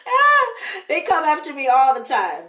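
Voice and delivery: laughing through the words. Real, not acted. Laughing to viewers about her critics, amused. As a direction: laugh as you say it.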